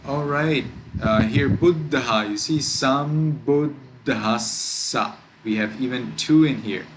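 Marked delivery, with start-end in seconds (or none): 1.5-2.3 buddha with a stop on the double d; stuck at the D. Shown as a demonstration. Teacher pointing out double consonants, buddha with the d held. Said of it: "get stuck at the D". 2.6-5.2 sambuddhassa slow, with a stop on dd and a long ss; stuck at D and S. Shown as a demonstration. Teacher showing two doubles, sambuddhassa held at d and s. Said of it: "stuck at the D and stuck at the S".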